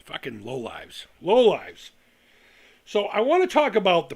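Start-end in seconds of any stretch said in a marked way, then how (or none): 0.0-1.1 low, soft and slow contempt; muttered insult. Real, not acted. About people mocking excitement, a low slow muttered insult. Insulting critics after rant. 1.2-1.9 loud sudden repeat; barked insult. Real, not acted. About people mocking excitement, a loud angry repeat. Insulting critics after rant.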